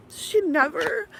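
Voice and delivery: she never breaking high; teary. Real, not acted. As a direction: let she never crack upward. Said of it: "I'm getting teary".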